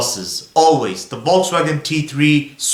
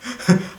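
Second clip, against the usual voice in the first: laugh; 7 semitones higher, 4 dB quieter than usual. Real, not acted. Laughs at his own memory.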